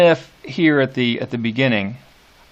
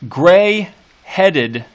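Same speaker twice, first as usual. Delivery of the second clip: "Gray-headed" with both syllables hit high and loud; spondee stress; 6 semitones higher, 6 dB louder than usual. Shown as a demonstration. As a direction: punch "Gray-headed" as two equal strong beats. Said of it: "we open up with a spondy".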